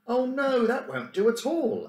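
high, loud protest, the Professor exclaiming. Acted. The Professor objecting to stealing the money, high loud exclaim. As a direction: cry out in dismay, high and loud. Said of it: "exclaimed the Professor".

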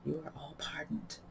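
hushed, unvoiced whisper; low voice. A narrator's reading. King to the company generally, in a whisper. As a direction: whisper it very softly. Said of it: "say in a low voice".

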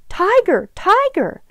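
high, loud storybook scream; screamed alarm. A narrator's reading. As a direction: scream a warning, pitch climbing. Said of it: "Sue screamed".

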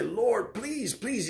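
high, soft crying plea; tearful pleading. Acted. Preacher crying out to the Lord, tearful high plea. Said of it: "I'm crying".

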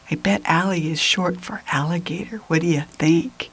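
playful aside. Shown as a demonstration.

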